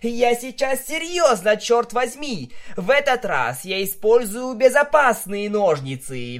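crying out. A narrator's reading. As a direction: insist loudly, voice up high. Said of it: "вскрикнула Винил в ответ".

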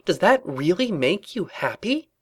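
high, pressing, incredulous questioning; impassioned rant. A narrator's reading. Twilight challenges Rarity about utopia, impassioned and high. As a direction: build a fervent challenge to a friend. Ranting against life under the princess.

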